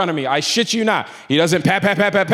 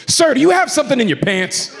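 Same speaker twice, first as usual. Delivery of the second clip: high-pitched, confrontational agent; pointed, raised-pitch questioning; with an attitude; 6 semitones higher than usual. Retold or imitated. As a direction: imitate an officious agent's challenge. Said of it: "comes at me with an attitude".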